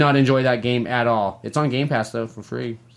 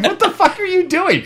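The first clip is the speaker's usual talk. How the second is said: laughing exasperation. Retold or imitated. Retold baffled outburst, laughing.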